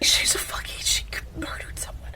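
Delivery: voiceless, wheezy disbelief; aghast, no voice left; incredulous whisper. Real, not acted. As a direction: whisper it as if you can't believe them. Incredulous reply to co-hosts' crush.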